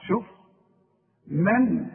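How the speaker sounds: usual speaking voice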